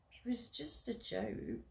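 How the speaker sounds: soft muttered excuse; defensive mutter. Acted. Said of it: "I mutter defensively".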